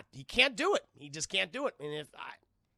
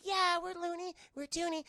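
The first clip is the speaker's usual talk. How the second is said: tiny eager Monstars; 13 semitones higher than usual. Retold or imitated.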